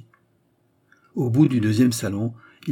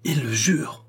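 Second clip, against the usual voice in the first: higher, indignant exclamation; a touch of fury, pitched up in outrage; carried-away fury; 5 semitones higher, slower than usual. A narrator's reading. Queen outraged at his oath, mildly furious exclamation. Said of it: "emportée à son tour par la fureur".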